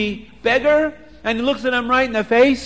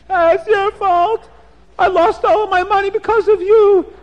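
sobbing; 13 semitones higher, 6 dB louder than usual. Acted. Beggar sobs to the rich man about his lost money, high sobbing wail.

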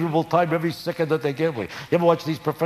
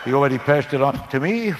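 lightly voiced Ed Sullivan. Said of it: "words of Ed Sullivan".

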